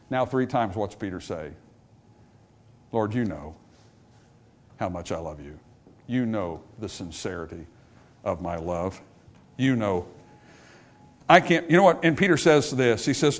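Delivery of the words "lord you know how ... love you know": soft, low pleading confession; humble sincerity. Retold or imitated. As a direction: offer it quietly and humbly, with pauses. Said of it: "Instead of boasting".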